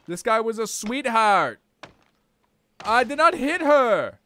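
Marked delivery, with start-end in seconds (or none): 2.9-4.2 1 insistent protest. Real, not acted. Denying in game.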